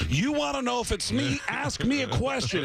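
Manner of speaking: high-pitched, emphatic challenge; [name]. Retold or imitated. Fake rock star proves identity to assistant, emphatic. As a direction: pompously dare them to test you. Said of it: "this is [name]".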